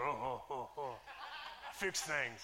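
low, soft laughing caveman grunts; Tim Taylor grunt. Retold or imitated. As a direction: grunt it out like a proud handyman, half laughing. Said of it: "you gotta grunt like Tim Taylor".

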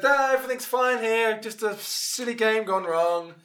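loud, high, drawn-out announcement; public reassurance. Acted. Marshal turning to the concourse, loud slow reassurance. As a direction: breezily project to a whole crowd.

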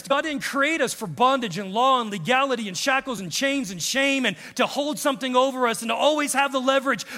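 ordinary talk

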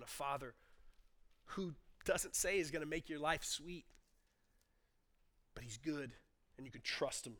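quiet, low, tender reflection; soft pastoral close. Acted. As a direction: settle into an intimate hush.

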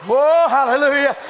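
loud revival preacher, pitch high and shouting; preaching at full force. Acted. Preacher shouting over a woman at the altar, full force. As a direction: preach it out to the whole hall.

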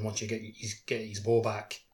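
usual speaking voice

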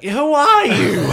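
shrill slow shout; high loud outburst. Acted. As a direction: yell it high and drawn out. Character snaps at newcomer.

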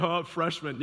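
low, jeering chuckle through the words; seniors' mocking laugh. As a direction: imitate older students teasing a newcomer.

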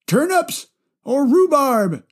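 put-on high old man voice, "rhubarb" falling; grandpa voice. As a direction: grandpa weighing "turnips or rhubarb", slowly. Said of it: "I just love doing my grandpa voice".

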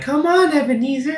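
put-on high, thin creepy child voice; eerie ghost child. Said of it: "creepy child voice".

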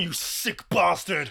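ordinary delivery

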